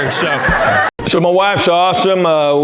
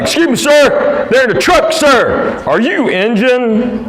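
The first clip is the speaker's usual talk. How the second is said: mock yelling stranger; 7 semitones higher than usual. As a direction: yell it up at him like the guy at the pump. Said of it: "starts yelling at me".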